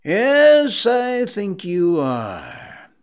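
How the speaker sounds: loud, high, long-drawn yes; mock-stern sarcasm. Scrooge agrees his clerk is late, mock-stern. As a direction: draw it out slowly, booming then falling.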